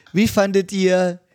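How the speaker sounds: ordinary talk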